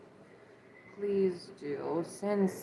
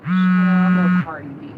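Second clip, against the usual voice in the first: joking shout; 12 dB louder than usual. Real, not acted. Joking she's no rapper.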